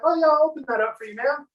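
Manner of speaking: put-on young salesman swooping in on a mom; eager young salesman. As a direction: play the overeager puppy salesman.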